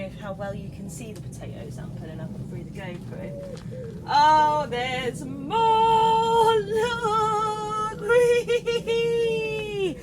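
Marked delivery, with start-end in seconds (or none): 4.1-10.0 overexcited squeal. Real, not acted. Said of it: "I'm getting too excited".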